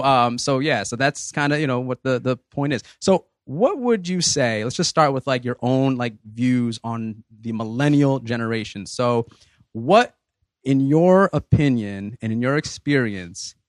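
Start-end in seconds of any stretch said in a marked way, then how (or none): none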